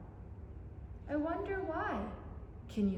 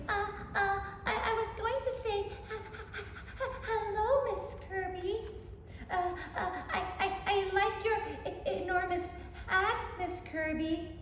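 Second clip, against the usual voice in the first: high, stuttering and fast; nervous stammer; 6 semitones higher than usual. Acted. Bobby meeting his scary teacher in the park, terrified stammer. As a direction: trip over every word in panic.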